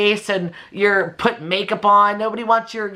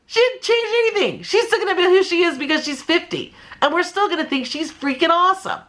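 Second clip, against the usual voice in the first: high, animated and laughing; high, excited, laughing defense; 10 semitones higher than usual. Real, not acted. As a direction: cheer her on, pitched up. Excited defense of Jennifer Aniston.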